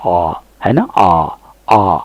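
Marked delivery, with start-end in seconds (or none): 0.1-0.3 "आ" rounded to aw, held and lower. Shown as a demonstration. Teacher showing the rounded mouth, aw pronunciation. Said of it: "मुंह को round करते हैं". 1.0-2.0 "आ" as a long rounded aw, twice. Shown as a demonstration. Teacher demonstrating the rounded vowel, aw pronunciation. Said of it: "मुंह को round करते हैं".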